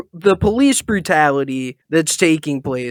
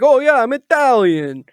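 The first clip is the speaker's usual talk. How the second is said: higher, hammed-up New York Italian guy; mock Italian guy; 8 semitones higher than usual. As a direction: puff up like a proud Jersey Italian. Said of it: "that type of guy is like".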